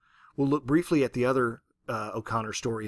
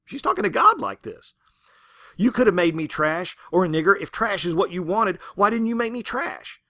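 loud, higher, indignant tirade; angry rant at God; 5 semitones higher, 6 dB louder than usual. Retold or imitated. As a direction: rail at heaven, louder and higher. Ruby raging at God.

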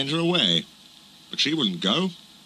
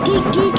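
high, loud "poop, poop"; whistled engine toot; 6 dB louder than usual. A narrator's reading. Gordon rushing through the station, high whistled toot. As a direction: toot it like a passing train whistle. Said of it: "he whistled".

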